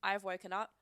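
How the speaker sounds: usual speaking voice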